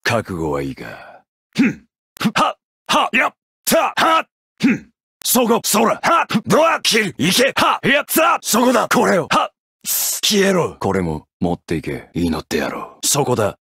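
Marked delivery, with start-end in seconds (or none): 1.5-10.8 short fighting yells; attack shouts. Acted. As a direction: spit each strike out.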